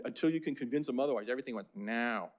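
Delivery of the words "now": lightly imitated negative manager; a hint of a naysaying boss; Dick Rawls. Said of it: "he talked like this".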